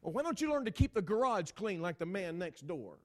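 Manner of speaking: loud retort, starting high and settling; mock indignant nagging; critical comeback. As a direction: throw the criticism back at your spouse. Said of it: "we start critiquing one another".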